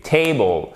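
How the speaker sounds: "Table" as "TAY-bol", first syllable stressed. Shown as a demonstration. Teacher models the tonic accent, first-syllable stress. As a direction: lean on the "Ta" of "Table". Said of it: "c'est celle qui est accentuée".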